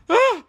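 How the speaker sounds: sudden high scared yelp; startled cinema scream. Retold or imitated. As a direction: shriek at the screen. Scared scream watching fight.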